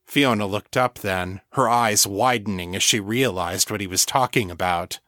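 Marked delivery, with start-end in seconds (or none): none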